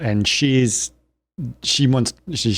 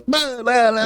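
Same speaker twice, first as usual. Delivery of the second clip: high, exaggerated fake Italian babble; cartoonish fake Italian patter, pitched high; mock Italian gibberish; 12 semitones higher, slower than usual. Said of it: "in Italian".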